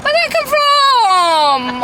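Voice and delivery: slow, high, loud indignant shriek; a mock-shocked cry at the teasing warning about falling off. Real, not acted. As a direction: pretend to be outraged at the jinx. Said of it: "Said about banter".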